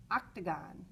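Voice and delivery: usual speaking voice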